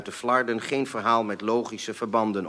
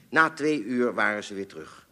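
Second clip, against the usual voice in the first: plain reading voice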